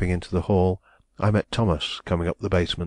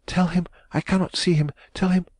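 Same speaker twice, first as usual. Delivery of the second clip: panicked order; 11 semitones higher than usual. A narrator's reading. Panicked order about the visitor.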